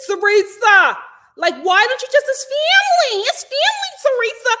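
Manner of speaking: high, loud, hammed-up East Coast Italian-American; mock Italian-American accent. Mocking Tracy pleading with Teresa about family, overdone Italian-American accent. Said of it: "I do not think that that woman talks like that".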